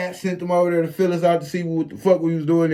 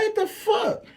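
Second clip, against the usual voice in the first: much higher, a touch softer, stunned; shocked what the fuck; 5 dB quieter, slower than usual. Retold or imitated. Seeing Big Hat get up, a high stunned exclamation. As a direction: gasp it out, can't believe it. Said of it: "I'm like".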